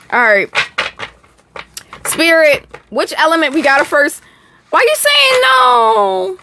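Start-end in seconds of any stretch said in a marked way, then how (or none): 4.7-6.4 mock offended.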